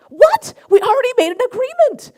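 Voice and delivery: outraged high, loud retort; loud, high-pitched mock fury; furious. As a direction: re-enact an indignant outburst, voice shooting up. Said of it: "And I was furious".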